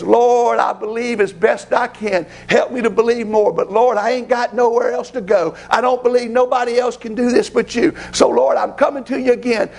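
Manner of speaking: pleading prayer. Acted. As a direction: beg the Lord steadily, never quitting.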